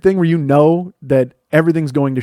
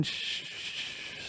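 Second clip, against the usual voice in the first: whispered shh imitating a frying steak; sizzle noise; 22 dB quieter, much slower than usual. Narrator imitating the steak sizzling in the pan. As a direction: hiss out the sizzle softly and slowly.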